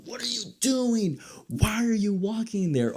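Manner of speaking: high-pitched yelled warning at a horror character; screaming at the TV. Retold or imitated. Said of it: "every time you're just screaming".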